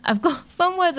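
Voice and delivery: high laughing repeat of fun words. Real, not acted. Host repeating fun words to listeners, laughing. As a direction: giggle through it, pitch jumping up.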